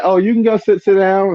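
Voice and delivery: high teasing female voice; sister-in-law voice, lifted pitch; imitating the sister. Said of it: "it's like".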